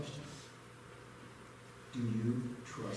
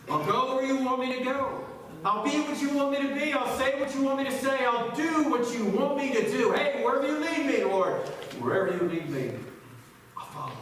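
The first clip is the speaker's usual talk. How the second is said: loud, high-pitched devotional vow; fervent pledge; 11 semitones higher, 8 dB louder than usual. Acted. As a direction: proclaim it like an eager congregation. Acts congregation's fervent pledge.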